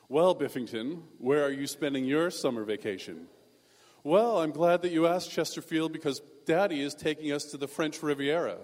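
mock snobbish rich-kid voice; snooty rich kids. Rich classmate bragging to another about summer plans, snobbish. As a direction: put on a pompous, self-important air. Mocking rich schoolmates' vacation talk.

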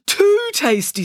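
high, pained emphasis; disgusted. A narrator's reading. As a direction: say it as if still tasting it. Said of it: "with a grimace".

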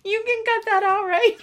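high, giggly plea; laughing. Real, not acted. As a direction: say it while still laughing. Laughing through her plea.

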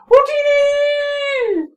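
shrill little Jawa squeal; high Jawa shout. Acted. Jawas shouting in trade language.

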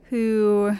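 ordinary delivery

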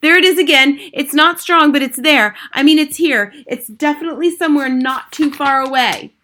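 high, loud, excited witch; shouting. A narrator's reading. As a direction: insist urgently to the whole room. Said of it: "shouted the first witch".

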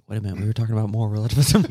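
rushed, fast eager blurt. Retold or imitated. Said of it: "I'm gonna be like".

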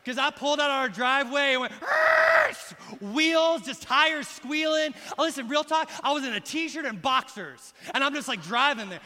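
pumped up like a car-chase movie; excited retelling. Real, not acted. Excitedly retells frantic drive.

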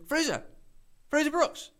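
high, surprised name-call at ordinary volume; calling out in recognition. Retold or imitated. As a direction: call a name as if spotting someone. Stranger recognising and calling him.

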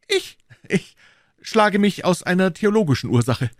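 high, other voice, hesitant start; Aramis. Acted. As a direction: evasive cleric-musketeer.

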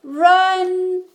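high, loud, held command; shout. A narrator's reading. Mom sees the bear, shouting to flee. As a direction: yell it long and urgent. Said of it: "shouted Mom".

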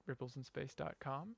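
ordinary delivery

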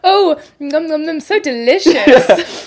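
high, loud, drawn-out mock yumminess; pretend delight. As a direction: fake loving a child's mud pie. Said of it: "it's like when a kid gives you".

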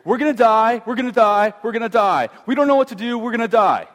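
panicked inner voice crying out; voice of fear. Retold or imitated. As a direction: let fear take over the voice. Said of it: "the voice of fears".

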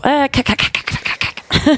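quick clipped k-k-k teal clucks; teal call clucks. Shown as a demonstration. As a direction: mimic a teal duck call with the mouth. Demonstrating teal duck call sounds.

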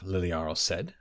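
plain reading voice

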